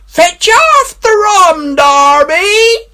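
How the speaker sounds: very high, loud drawn-out call; eerie distant howl; ghostly wailing cry. A narrator's reading. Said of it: "rising a little higher".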